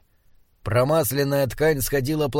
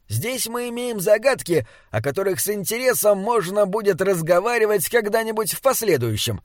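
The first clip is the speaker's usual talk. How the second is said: higher-voiced troll shutting down talk; cutting off; 7 semitones higher than usual. A narrator's reading. Binabik ends talk of the riddles, cutting it off. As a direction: firmly put the question aside. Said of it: "отрезал Биннебик".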